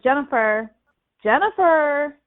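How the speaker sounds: mock hollering. Dad trying to get her attention, mock hollering. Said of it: "just, like, hollering".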